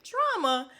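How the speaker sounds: high, disbelieving echo of the word; mock shock, as if she can't believe it; incredulous. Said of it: "And I'm like".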